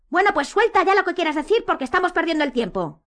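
high, loud, exasperated shouting; impatient shout. A narrator's reading. Harry demands Hermione finish, shouting. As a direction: shout at them to get on with it. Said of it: "gritó Harry".

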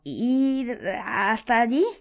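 slow, drawn-out stammer. A narrator's reading. Ron answers Harry's question, stammering in disbelief. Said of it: "Ron balbuceó".

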